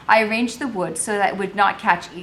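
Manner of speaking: usual speaking voice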